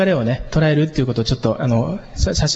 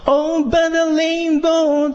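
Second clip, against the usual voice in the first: synth-like voice; 12 semitones higher, much slower than usual. Retold or imitated. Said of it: "シンセみたいな声でやる".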